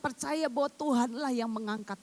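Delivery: preacher pressing the church not to complain, fervent; fervent preaching. Acted. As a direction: drive the point home to the congregation. Preacher builds to full force.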